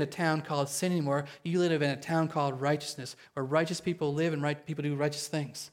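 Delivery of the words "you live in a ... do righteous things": usual speaking voice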